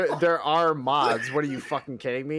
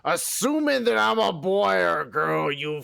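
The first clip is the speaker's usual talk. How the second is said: hammed-up angry tirade, pitched up; mock outrage; 3 semitones higher than usual. Co-host rants at being called boy or girl, mock furious. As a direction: berate them with theatrical offense.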